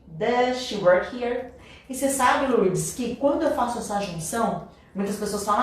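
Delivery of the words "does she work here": DOES SHE WORK HERE slowly, DOES SHE as "duh-shee"; linked speech. Shown as a demonstration. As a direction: stretch DOES SHE WORK HERE but keep one SH. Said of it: "mais natural pra gente juntar".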